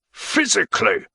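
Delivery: high, sharp reply; sharp one-word retort. A narrator's reading. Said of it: "he said sharply".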